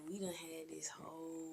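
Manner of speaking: hushed joking whisper. Real, not acted. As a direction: whisper it like a secret. Joking aside about not cursing.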